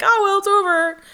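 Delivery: high, loud mocking imitation; mock someone airily brushing it off; exaggerated sing-out of a brush-off; super dismissive. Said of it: "were, like, super dismissive".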